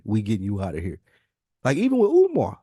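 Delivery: ordinary delivery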